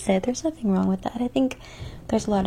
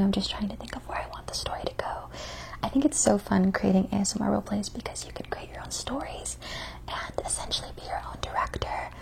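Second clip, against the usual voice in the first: soft ASMR whisper; 5 dB quieter than usual. Acted. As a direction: whisper close to the mic.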